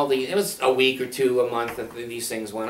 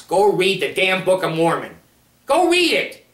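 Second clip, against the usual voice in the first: much higher, louder jab; raised, heated order; heated demand; 5 semitones higher, 5 dB louder than usual. Real, not acted. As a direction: challenge them, hot. Heated challenge to listener.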